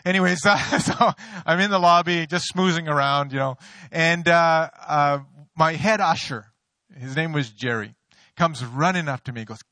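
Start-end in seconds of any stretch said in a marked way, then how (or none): none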